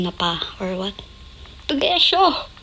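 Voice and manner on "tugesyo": high playful call to dad; calling out nickname. Shown as a demonstration. Daughter calling her dad by his nickname, playful shout. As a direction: sing out his nickname across the house. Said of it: "Mga ganun ako".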